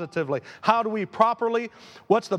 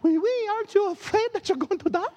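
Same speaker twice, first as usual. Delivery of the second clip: hammed-up French princess in very high falsetto; French-accented princess; 13 semitones higher than usual. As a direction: squeak it out as a mock French princess. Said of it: "She had, like, a French accent".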